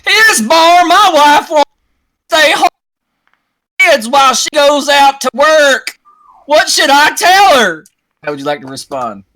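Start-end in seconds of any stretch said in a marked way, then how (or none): 0.1-7.8 high, loud complaining shout; desperate follower calling to Isbar; Klansman crying out. Acted. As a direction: beg the leader for advice, loudly. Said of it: "another Klansman cries out".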